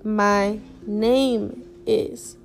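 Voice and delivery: very slow, each syllable drawn out; slow demo. Shown as a demonstration. Teacher slowly modelling "Mi nombre es" for students. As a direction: spell it out slowly for the learner. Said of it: "you could say".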